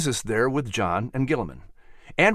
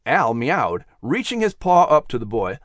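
ordinary delivery

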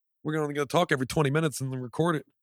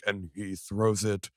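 sportscaster; 6 semitones lower, 4 dB quieter, slower than usual. Retold or imitated. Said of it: "there was a sports cast".

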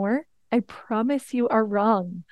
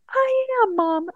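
put-on high, light voice of a cheerful little boy; happy kid voice; 13 semitones higher, 3 dB louder than usual. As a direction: play the beaming son, light and high. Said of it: "And he was like".

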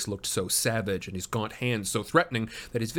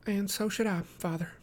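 put-on high-pitched, softer young woman; spirited daughter; 10 semitones higher, 3 dB quieter than usual. A narrator's reading. Daughter agreeing with her father, spirited. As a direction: answer your father with spirit, lightly. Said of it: "she answered with spirit".

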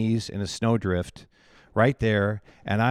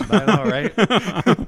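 real laugh, much higher and slow, louder; 9 semitones higher, 7 dB louder, much slower than usual. Real, not acted. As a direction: laugh it out while agreeing. Laughs along at the joke.

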